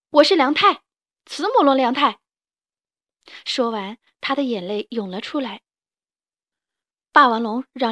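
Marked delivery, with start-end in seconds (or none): 0.1-2.2 loud high proud declaration; defiant child. Acted. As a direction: declare it with pride.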